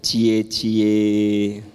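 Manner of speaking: mock wife's sing-song cie cie; teasing wife. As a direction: tease him like a coy wife. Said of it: "istri Bapak bilang gini".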